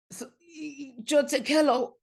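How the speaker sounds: high, halting child imitation; nervous child retold. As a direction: stammer it out high in American-accented Spanish. Scared child repeats the wrong Spanish.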